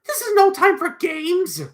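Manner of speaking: high, loud telling-off; indignant scolding. Acted. Missus Bold scolding whoever throws things, high indignant cry.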